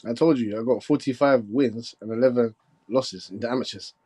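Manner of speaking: ordinary talk, nothing marked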